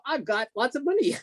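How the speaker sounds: high, bright-eyed joy; a retold gleeful announcement; giddy excitement. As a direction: blurt it out, thrilled and amazed. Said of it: "I go".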